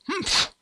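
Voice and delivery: high indignant huff; huffy humph. Acted. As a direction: sniff off the insult with a high huff. Offended spider huffs.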